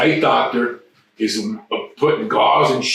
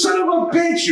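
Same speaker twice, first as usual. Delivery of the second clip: angry curse. Retold or imitated. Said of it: "He goes".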